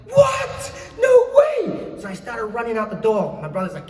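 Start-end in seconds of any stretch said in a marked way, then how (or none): 0.1-2.0 very high, loud cry of disbelief; exploding with retold excitement; stunned shout. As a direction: re-enact a shriek of amazement. Retold reaction to getting the role.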